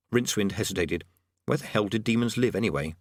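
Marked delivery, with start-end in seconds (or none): none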